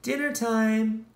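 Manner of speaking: cheerful dinner-time call. Acted. Character calls out.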